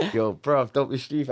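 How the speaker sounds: imitating his friend JK's London street accent. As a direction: punch it out like your mate JK. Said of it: "There's a J K accent".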